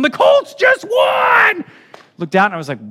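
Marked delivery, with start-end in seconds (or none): 0.0-1.6 wild, very high drawn-out fan yell; imitated celebrating shriek, loud and stretched. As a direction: scream it like a crazed fan in the street. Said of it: "running down my street yelling".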